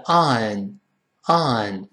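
"on" as long open "ah" plus N; correct British sound. Shown as a demonstration. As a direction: stretch "on" slowly as "ahn". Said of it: "是on，on".

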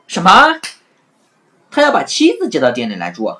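a high loud cry of outrage; outraged. Acted. Innkeeper hearing the news, high outraged shout. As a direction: stamp your feet with fury. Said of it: "老板气得直跺脚".

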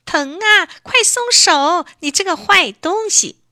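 high, loud cry of pain, slowed on 快松手. A narrator's reading. Elsie pinched by lobster, crying out in pain. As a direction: yelp it through tears. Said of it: "眼泪也流出来了".

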